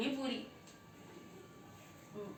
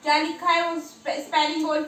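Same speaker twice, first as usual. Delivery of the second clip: loud, higher exasperated question; 5 semitones higher, 12 dB louder than usual. Real, not acted. Mother checks child's writing, loud and exasperated. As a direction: demand an answer, fed up.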